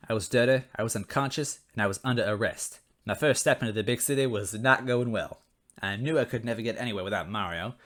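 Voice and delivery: ordinary delivery, nothing marked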